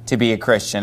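hesitant grudging admission; lightly voiced reluctant king; halfway answer. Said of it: "kind of a halfway answer".

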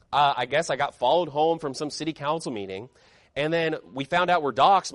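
ordinary talk, nothing marked

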